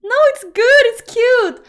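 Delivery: high, loud, pleased exclamation; delighted surprise. Real, not acted. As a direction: gush that it's adorable. Delighted by Finnish pronunciation.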